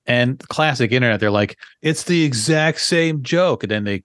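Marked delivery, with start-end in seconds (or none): 1.8-3.6 higher-pitched, drawn-out imitation of critics; mock accusation. Podcaster mimicking internet commenters accusing a comic of stealing, mock accusation. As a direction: mimic indignant critics, stretching it slower.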